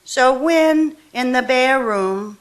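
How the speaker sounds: slow, measured poetry reading; a poet reading her own verse slowly to the room; poem reading. Acted. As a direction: lay each line down slowly, letting the breaks breathe. Reads poem Lesson.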